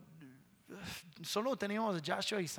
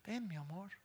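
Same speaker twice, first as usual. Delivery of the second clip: gentle call; 10 dB quieter than usual. Retold or imitated. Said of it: "cuando yo les hablo regular".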